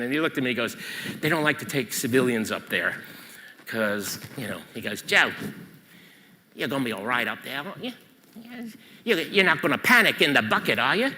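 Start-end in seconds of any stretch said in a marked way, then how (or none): 1.2-4.2 low, lightly put-on English accent; bad English accent. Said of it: "I'm gonna do a bad English accent". 5.0-11.1 amateurish English bloke impression; bad English accent. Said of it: "I'm gonna do a bad English accent".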